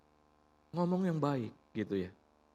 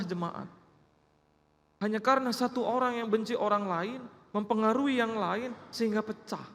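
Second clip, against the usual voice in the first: higher, louder pulpit preaching; preacher exhorting the church, full force; fervent preaching; 8 semitones higher, 3 dB louder than usual. Acted. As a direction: drive the point home to the congregation.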